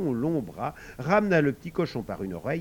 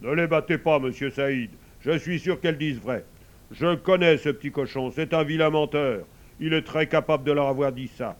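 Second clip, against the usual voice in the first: lightly voiced sun, louder and higher; projected, reasoning character voice; intervening sun; 4 semitones higher, 7 dB louder than usual.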